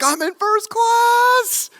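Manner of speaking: giddy thrill. Retold or imitated. Thrilled in first class.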